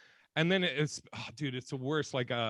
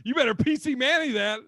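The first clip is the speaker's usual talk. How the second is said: laughing protest; 9 semitones higher, 8 dB louder than usual. Real, not acted. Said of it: "Why would she have that voice".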